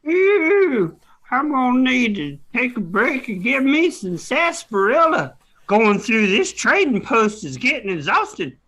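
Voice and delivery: put-on a cranky old prospector griping; cowboy prospector. S0 plays a Western prospector.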